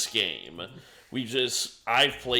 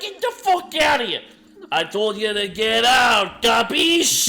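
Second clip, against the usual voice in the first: loud, high Italian-American yell; mobster shout; 13 semitones higher, 11 dB louder than usual. Acted. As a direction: throw him out at full voice. Louis yells at interrogator.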